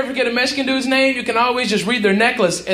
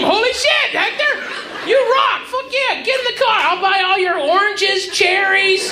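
high-pitched, gushing excitement; thrilled amazement; 6 semitones higher than usual. Acted. Driver cheers Hector for fixing the car, thrilled. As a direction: celebrate as if a miracle just happened.

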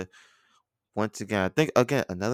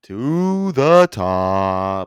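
hyped catchphrase; 9 dB louder, much slower than usual. Acted. Shouting team catchphrase.

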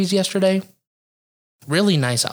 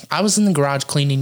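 normal talking voice

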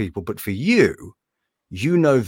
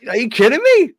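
excited; 11 semitones higher, 6 dB louder than usual. Real, not acted. Overjoyed about meeting his hero.

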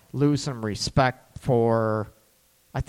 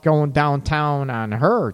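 usual speaking voice

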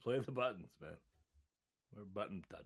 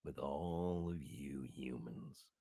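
fed-up seal; 5 semitones lower than usual. Acted. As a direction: lecture humans as a fed-up seal. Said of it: "I talked to a seal once".

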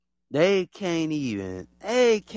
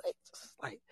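whisper; 19 dB quieter than usual. Real, not acted. Whispered aside.

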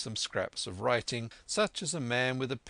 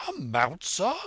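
loud, high, incredulous question; excited cry; 7 semitones higher, 6 dB louder than usual. A narrator's reading. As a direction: squire exclaiming in disbelief. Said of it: "cried the squire".

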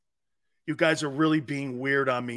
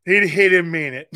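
louder, laughing through the words; laughing repeat; 9 dB louder than usual. Real, not acted. Host laughs, repeating his aunt's excuse for him. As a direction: crack up while repeating the aunt.

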